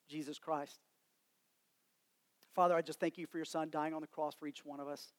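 soft, hushed pastor's prayer. Real, not acted. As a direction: pray quietly over the church. Leads congregation in prayer.

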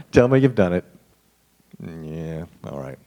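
ordinary talk

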